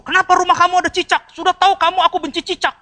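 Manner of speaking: high, loud indignant rebuke; mock angry scolding. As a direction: scold the friend for the gecko. Acts angry complaint at host. (omelan marah pura-pura)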